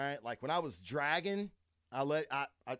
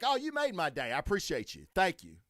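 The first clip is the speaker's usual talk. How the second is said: higher, louder mock gratitude; angry shopper mocking the woman, sarcastic; sarcastic sendoff; 4 semitones higher, 4 dB louder than usual. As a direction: drip sarcasm, then snap. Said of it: "I'm like".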